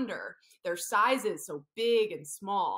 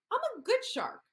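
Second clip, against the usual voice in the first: lightly voiced high little shark; a small, eager shark; baby shark voice; 13 semitones higher than usual. Therapist voicing Baby Shark for the kids, high. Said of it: "Baby shark said to himself".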